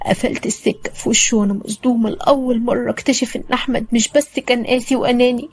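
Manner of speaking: ordinary delivery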